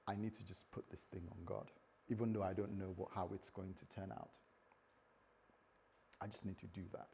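very soft, low inner voice; quiet resolve. Acted. David deciding to trust God despite uncertainty, quietly resolved.

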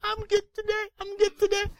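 put-on very high squeaky little girl voice; toddler voice. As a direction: play a tiny three-year-old chirping back. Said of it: "three years old ... And she goes".

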